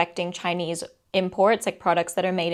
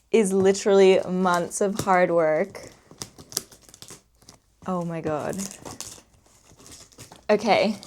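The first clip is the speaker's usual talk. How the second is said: ordinary delivery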